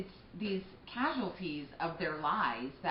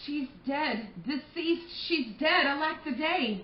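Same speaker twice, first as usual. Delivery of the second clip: higher, louder wailing news; grieving announcement; 7 semitones higher, 5 dB louder, slower than usual. Acted. Nurse telling Lord Capulet his daughter is dead, wailing. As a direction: break it to him in tears.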